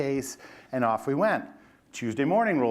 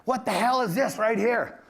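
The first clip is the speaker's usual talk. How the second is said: angry boss; 9 semitones higher than usual. Retold or imitated. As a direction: demand an answer like a furious boss. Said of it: "And he goes".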